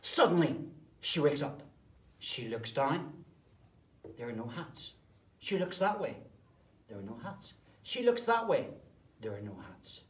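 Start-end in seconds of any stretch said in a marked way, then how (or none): 0.0-3.2 loud, punchy storyteller beats; sudden loud storytelling; dramatic loud build. A narrator's reading. 5.4-6.2 searching. A narrator's reading. Searching for hats. 7.8-8.7 searching. A narrator's reading. Searching for hats.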